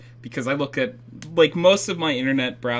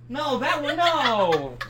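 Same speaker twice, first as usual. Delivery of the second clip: laughing protest; 11 semitones higher, 4 dB louder, much slower than usual. Real, not acted.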